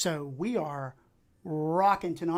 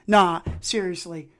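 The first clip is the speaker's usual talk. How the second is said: normal talking voice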